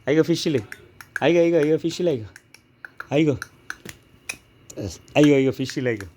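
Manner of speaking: playful oh-no cries; mock dismay. Real, not acted.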